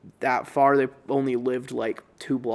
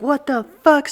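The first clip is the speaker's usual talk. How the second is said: high, loud shrieked outburst; mom freaking out; 12 semitones higher, 7 dB louder than usual. Retold or imitated. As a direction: shriek it in shocked rage. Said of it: "Starts losing her shit".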